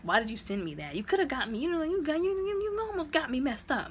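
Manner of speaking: climbing into a high-pitched protest; retold indignant complaint. Retold complaint after the fight.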